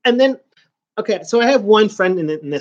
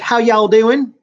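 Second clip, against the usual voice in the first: slow, higher, louder mock Southern drawl; attempted Southern accent; 5 semitones higher, 6 dB louder, slower than usual. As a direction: try on a Southerner's greeting, stretched out. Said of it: "that's me trying to be a, a, a Southerner".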